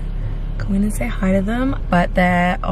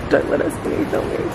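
ordinary talk

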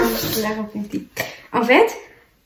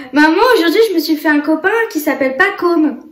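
put-on high, eager little boy; 5 semitones higher, much faster than usual. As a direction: play the excited toddler. Mother voicing her small son. (petite voix d'enfant)

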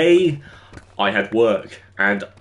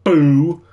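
"boo" punched louder and higher; emphatic boo; 5 semitones higher, 6 dB louder than usual. Shown as a demonstration. Streamer demonstrating the emphasis to viewers, emphatic "boo". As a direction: hit "boo" hard and draw it out. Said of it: "the emphasis I put on the".

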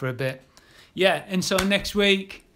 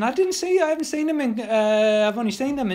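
excited; 7 semitones higher, 3 dB louder than usual. Real, not acted. Excited about sail bunting.

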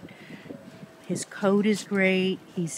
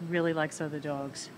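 ordinary talk

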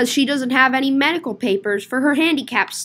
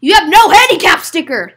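loud, higher outburst; loud accusation; 4 semitones higher, 10 dB louder than usual. Acted. Accusing a customer over the handicap sticker, loudly. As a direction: call it out in one loud run. Same line to rule-breaking customer.

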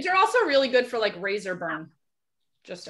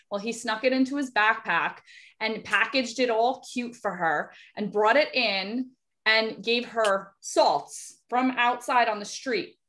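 ordinary delivery

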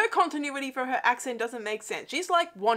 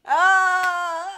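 loud, high "ah" of delight; delighted squeal; 9 semitones higher, 8 dB louder than usual. Real, not acted. Fan hearing the yellow spandex joke, delighted squeal. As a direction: let out a long, higher squeal.

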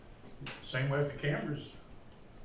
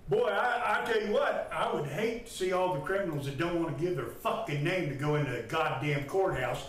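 angry raised voice; 3 semitones higher than usual. Real, not acted. Detainee ranting back at officers over charges, angry raised voice. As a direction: really rail at them, voice raised. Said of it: "you lower your tone".

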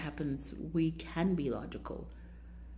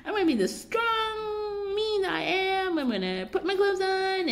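much higher, sing-song fake toughness; mock bravado; 13 semitones higher than usual. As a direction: send up her own false courage. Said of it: "And then I was like".

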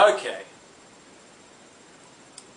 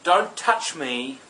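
higher and louder, slow furious outburst; enraged; 7 semitones higher, 7 dB louder, much slower than usual. A narrator's reading. Witch snapping at the mirror, enraged and loud. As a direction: shove him away in a rage. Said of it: "She'd get all enraged".

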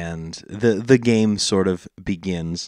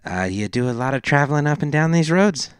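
higher-pitched gnome question, lifting to the end; 7 semitones higher, 3 dB louder, faster than usual. Acted. Gnome questioning Fitz about travelling, higher character voice. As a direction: voice a nosy gnome making small talk. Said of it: "intercepted by the gnome".

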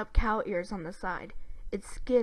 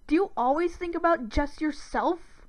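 high-pitched scornful question; sneering accusation; 8 semitones higher, 4 dB louder than usual. A narrator's reading. Brother calling his sister selfish, sneering. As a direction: fling it as an indignant challenge. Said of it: "Dib sneered".

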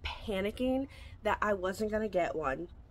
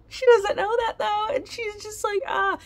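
mock anguish; 13 semitones higher, 5 dB louder than usual. Girl torn between two guys.

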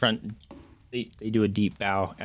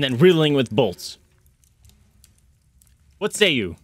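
captain briefing, higher and emphatic; captain figure; 5 semitones higher, 4 dB louder than usual. Acted. Captain pitching his hunting plan to the party, emphatic. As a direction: lay out a battle plan as the captain. Game master plays the captain.

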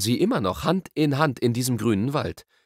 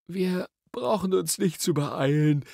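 slow, higher, drowsy drawl; yawning; 8 semitones higher, slower than usual. A narrator's reading. Diggory tells Polly there's no hurry, yawning. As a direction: stretch the words through a yawn. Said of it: "sagte Diggory und gähnte ausgiebig".

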